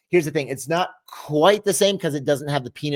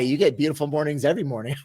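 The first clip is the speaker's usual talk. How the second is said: ordinary delivery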